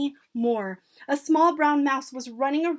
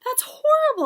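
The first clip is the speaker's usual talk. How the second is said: high, loud outburst of outrage; indignant cry; 9 dB louder than usual. A narrator's reading. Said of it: "I cried".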